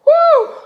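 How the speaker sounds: a high, loud joyful woo. Real, not acted. Celebrating a thousand subscribers with a high loud woo. Overjoyed reaction sound.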